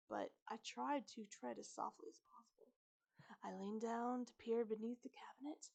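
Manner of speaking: usual speaking voice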